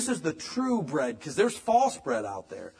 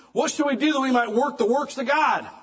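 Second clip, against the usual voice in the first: high, loud crowd demanding an answer; loud questioning crowd; 10 semitones higher, 5 dB louder than usual. Acted. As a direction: call it out as the eager crowd.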